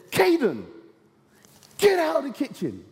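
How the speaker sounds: put-on high, sharp call of a mother shooing a child; stern mum ordering. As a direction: bark it like a busy mum clearing the kitchen.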